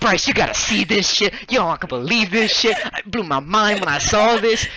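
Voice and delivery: loud, high hyped-up pitch; big brother hyping up a prank. Retold or imitated. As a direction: sell it like the best secret ever. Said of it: "I'm getting hyped up on it".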